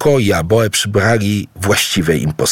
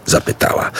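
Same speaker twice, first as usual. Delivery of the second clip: usual speaking voice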